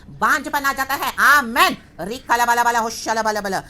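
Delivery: loud, high shout into tongues; fervent preaching climax. Real, not acted. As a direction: cry it out to the congregation. (जोश में ऊँची आवाज़ में प्रचार)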